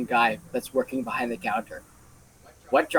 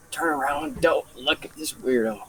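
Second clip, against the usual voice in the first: ordinary talk, nothing marked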